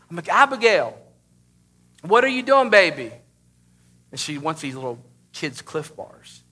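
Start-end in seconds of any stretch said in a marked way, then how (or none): 0.3-3.1 put-on loud, high-pitched dad calling to his child. Dad on the couch to daughter in pantry, calling out. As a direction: call out in raised-voice parental bafflement.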